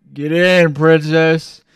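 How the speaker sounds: loud, swaggering get in princess; cocky loud command. Retold or imitated. Driver tells stunned friend to get in, loud and cocky. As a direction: order him in with bravado.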